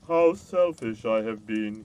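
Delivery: loud, slow, grieving giant voice; the giant realising his guilt, slowly; a big slow voice full of regret; remorseful giant. A narrator's reading.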